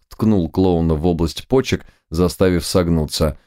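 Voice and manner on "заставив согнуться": usual speaking voice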